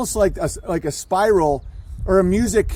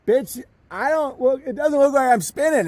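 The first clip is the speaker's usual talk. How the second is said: mock sassy retort; 7 semitones higher than usual. Answering NASA's claim that we're spinning, mock retort. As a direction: talk back in a put-on higher voice. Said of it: "And it's like".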